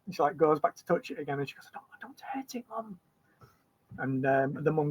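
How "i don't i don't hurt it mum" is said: put-on hushed, worried little girl's whisper; child's whisper. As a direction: whisper it so the bird isn't startled. Girl stroking bird whispers to mum.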